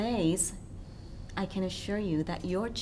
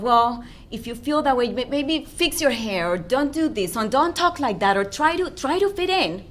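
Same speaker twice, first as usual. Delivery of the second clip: louder, higher mock parental advice; nagging parent; 4 semitones higher, 7 dB louder than usual. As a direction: rattle off corrections like a fussing parent. Voicing a parent correcting a child.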